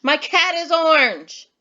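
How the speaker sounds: loud, high exclamation; with emotion. Shown as a demonstration. Teacher testing pupils on exclamation points, emotional exclaiming. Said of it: "saying it with some emotion".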